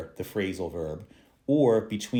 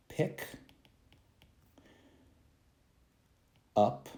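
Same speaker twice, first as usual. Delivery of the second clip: slow word-by-word example; much slower than usual. Shown as a demonstration. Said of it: "If I say".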